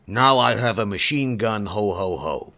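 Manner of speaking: normal talking voice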